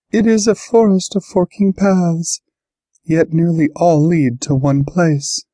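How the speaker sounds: high-pitched otherworldly soothsayer; cryptic oracle creature. A narrator's reading. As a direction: pitch it high, like a riddling prophecy. Voices the Chandara foretelling the future.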